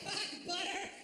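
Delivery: high squawked curse; badly faked outburst; mock mad. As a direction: squeal it in pretend anger. Repeats the failed mad outburst.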